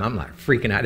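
usual speaking voice

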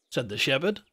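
ordinary delivery, nothing marked

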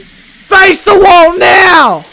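loud, high and very slow order; drawn-out furious yell. Pretend. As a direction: stretch the shout out in anger. Cop yelling at suspect.

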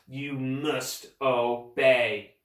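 very slow, deliberate order; measured villainous ultimatum; menacing command. Acted. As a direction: draw out each word as a threat. Villain gives a final order.

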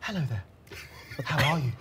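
very soft, low noble English accent; hushed aristocratic British greeting. Shown as a demonstration. Asked to show a noble accent, hushed posh British English. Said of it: "a noble accent?".

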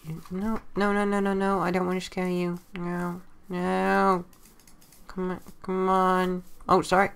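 high, gentle coaxing coo; cooing at a cat. Real, not acted. Player coaxes a jungle cat closer, high gentle coo. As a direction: coax the cat not to run.

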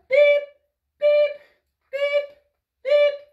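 high, loud imitated sonar beep; submarine beeps. Instructor playing submarine with children, high loud beeps.

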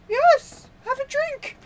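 very high, loud, laughing host voice; giddy Pretorius voice. Retold or imitated. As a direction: offer it with manic delight. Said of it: "And he's like".

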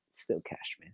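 muttered half to himself; quiet aside. Real, not acted.

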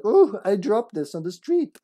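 mock casual innocent voice; fake innocent. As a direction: act like you dropped it by accident. Asks Cindy to fake-drop herb.

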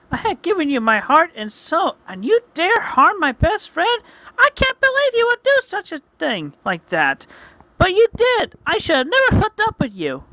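high, loud heroine scolding her ex; shrill-pitched, hurt tirade, acted out; betrayed outrage. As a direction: let him have it in a high female character voice.

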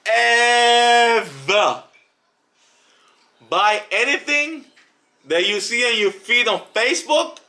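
high, slow, weighty warning; emphatic warning. Real, not acted. Telling viewers never to buy from feed ads, high and slow. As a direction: lean hard on ever, then spell it out slowly.

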